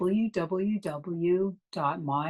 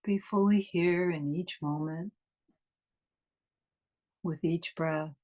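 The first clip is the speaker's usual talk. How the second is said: guided meditation. Acted. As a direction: ease them inward, unhurried and calm.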